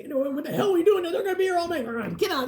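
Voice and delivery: high, fast, half-laughing grumble; mock angry crowd. Barefoot people in line complain about the hold-up, mock angry. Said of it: "People are cussing".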